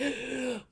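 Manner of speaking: sharp startled intake of breath; panicked gasp. Retold or imitated. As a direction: gasp in alarm. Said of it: "ego tries to boost itself up".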